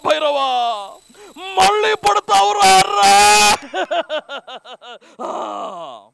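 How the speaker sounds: loud, high, slow heroic roar; impassioned movie declamation with stretched words; Srihari. Acted. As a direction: thunder out the film dialogue, building to a long held scream. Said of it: "Srihari గారు మగధీరాలో చెప్పిన dialogue Srihari గారి style".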